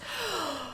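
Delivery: big audible gasp for air; deep breath. Real, not acted. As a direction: suck in a deep, quick breath. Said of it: "I need to take a deep breath".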